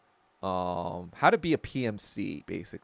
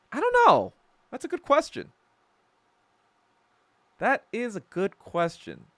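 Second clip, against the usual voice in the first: high playful; 11 semitones higher, 5 dB louder than usual. Real, not acted. Reacting to a funny question.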